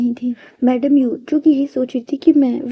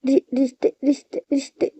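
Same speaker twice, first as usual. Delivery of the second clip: stammering. A narrator's reading. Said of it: "वो बोल ही नहीं पा रहा था".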